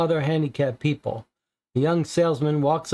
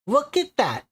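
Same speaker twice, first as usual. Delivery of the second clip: higher put-on old landlady voice; elderly landlady; 8 semitones higher than usual. Landlady telling the visitors about her tenant, higher old-woman voice. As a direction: play the chatty old landlady, pitched up.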